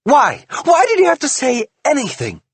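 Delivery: high, loud furious demand; furious question. A narrator's reading. Wife furious husband told the cops. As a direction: demand it in fury.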